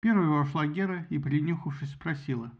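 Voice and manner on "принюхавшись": ordinary delivery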